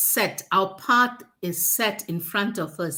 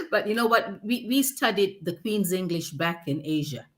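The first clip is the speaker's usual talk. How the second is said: ordinary talk, nothing marked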